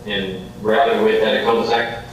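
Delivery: ordinary talk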